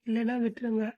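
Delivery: normal talking voice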